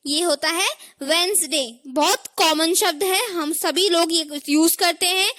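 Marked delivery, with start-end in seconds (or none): 1.0-1.8 वेंसडे as "wens-day", first d silent; correct pronunciation. Shown as a demonstration. Teacher asking learners to repeat, वेंसडे as "wens-day". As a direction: say वेंसडे in two syllables. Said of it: "आप लोग repeat करिए".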